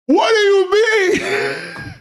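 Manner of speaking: high, drawn-out cry of disbelief; outraged shriek. Real, not acted. Streamer shrieking at chat over the news, outraged. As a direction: wail it out, outraged and stunned.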